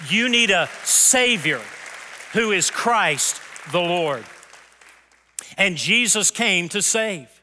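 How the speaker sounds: loud, slow, high proclamation; emphatic preaching. Real, not acted. Preacher tells the congregation they need a Savior, forceful and slow. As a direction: proclaim it at full force, phrase by phrase.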